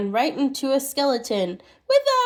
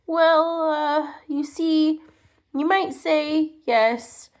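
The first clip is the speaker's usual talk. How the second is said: slow, higher, hesitant little man; shy squeaky stammer; 4 semitones higher, slower than usual. Acted. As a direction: stammer it out shyly. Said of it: "stammered ... in a shy, squeaky voice".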